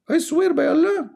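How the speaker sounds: very high squeaky mock god voice; mock divine voice. Streamer mocking the caller's God, shrill mock voice.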